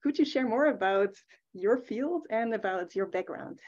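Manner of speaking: ordinary talk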